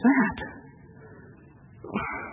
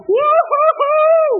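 long whoop; 5 dB louder, much slower than usual. Real, not acted. Preacher celebrating the exodus.

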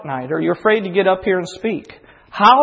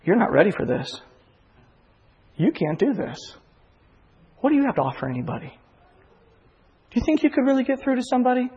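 voice of doubt; 6 semitones higher than usual. Retold or imitated. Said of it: "the doubt will come in and it'll say".

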